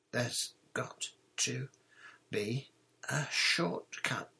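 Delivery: slow, out of breath from climbing; panting. A narrator's reading. Boy climbing a seventh long staircase, panting. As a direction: puff the words out one at a time. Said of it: "Ron panted as they climbed".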